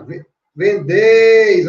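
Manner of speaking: slow, emphatic teaching demo; loud stress demo. Shown as a demonstration. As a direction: hit "vendeis" hard on the last syllable. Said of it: "a risotônica".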